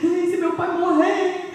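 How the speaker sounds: panicked caller. Retold or imitated. Ex-sister-in-law calls about her sick father, high frightened voice. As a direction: voice her frightened phone call.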